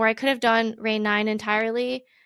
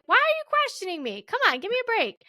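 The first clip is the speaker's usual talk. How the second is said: higher, fed-up whine of indignation; mock exasperated; 11 semitones higher than usual. To her team who questioned her time, higher exasperated protest. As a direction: push back on the team, exasperated. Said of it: "then I started to get mad".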